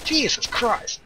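high, indignant shock at being shot; indignant outburst. Real, not acted. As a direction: yelp it out, genuinely outraged. Teammate shoots him in game.

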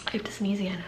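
whispered aside. Real, not acted. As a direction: breathe it out in a whisper.

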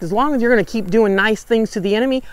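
normal talking voice

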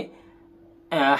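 ordinary talk

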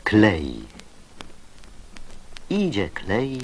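normal talking voice

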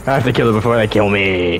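ordinary talk, nothing marked

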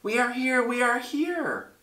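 very high, tiny voices crying out; tiny fearful cry. Acted. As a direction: squeak it like tiny frightened people. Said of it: "They cried out in fear".

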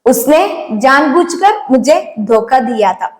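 slow, deliberate Hindi sentence; teacher modelling a Hindi sentence slowly; slow model sentence. Shown as a demonstration. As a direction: read it slowly so students follow. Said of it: "അവൻ എന്നെ മനഃപൂർവ്വം ചതിച്ചു".

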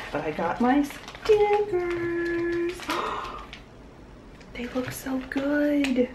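delighted gush. Real, not acted. Gushing over new stickers.

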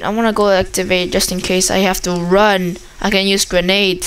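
ordinary delivery

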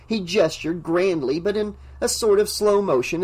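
ordinary talk, nothing marked

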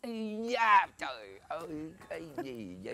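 very faint, low groan; drained, barely voiced suffering; groaning with craving. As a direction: moan it weakly, as the craving hits. Drinker groaning through craving attack.

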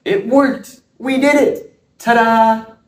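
high triumphant cheer; excited. Acted. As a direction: celebrate the trick landing. Magic finally works.

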